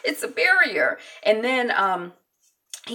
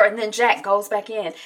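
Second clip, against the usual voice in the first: ordinary delivery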